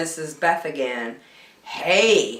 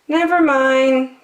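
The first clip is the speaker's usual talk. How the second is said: much higher, friendly zebra voice; Zebra's voice; 9 semitones higher, 3 dB louder than usual. A narrator's reading. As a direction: raise the pitch for helpful Zebra. Zebra comforts Bear.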